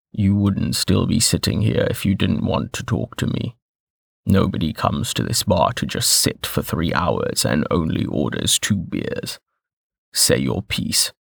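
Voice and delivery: low, rough voice with gravel in the throat; rough gravelly voice. A narrator's reading. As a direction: grind the words out low and rough. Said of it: "His voice was rough, like there was some gravel grinding".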